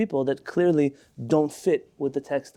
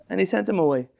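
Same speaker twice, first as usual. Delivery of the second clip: ordinary delivery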